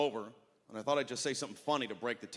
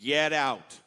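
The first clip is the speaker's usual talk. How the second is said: firm bouncer; 2 semitones higher, 6 dB louder, slower than usual. Retold or imitated. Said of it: "He's like".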